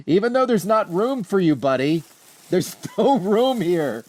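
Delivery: high, sing-song voice for a pet; gently scolding the dog who won't stop climbing on the couch; talking to the dog. Real, not acted. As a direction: coo at the dog, teasing him. Talking sweetly to his dog.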